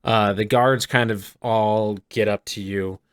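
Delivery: normal talking voice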